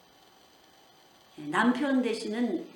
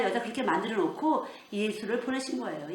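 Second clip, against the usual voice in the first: usual speaking voice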